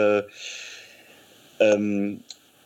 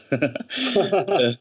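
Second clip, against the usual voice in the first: stifled giggles; 6 semitones higher than usual. Real, not acted. Recalls laughing at hopping Yoda.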